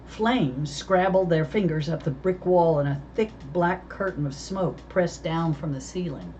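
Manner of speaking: ordinary delivery, nothing marked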